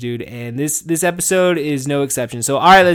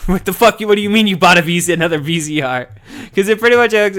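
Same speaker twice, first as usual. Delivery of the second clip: loud, raised mock outrage; husband voicing his wife's loud disbelief; imitating angry wife; 6 semitones higher, 7 dB louder than usual. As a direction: play the wife catching him with a second VCR. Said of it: "wife confronting him".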